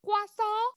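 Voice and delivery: high-pitched, rising "Croissant"; playful French croissant. Pretend. Playful French pronunciation attempt.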